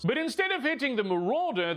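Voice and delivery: ordinary talk, nothing marked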